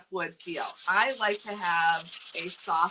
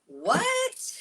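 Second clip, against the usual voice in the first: surprised. Real, not acted. Surprised by a comment.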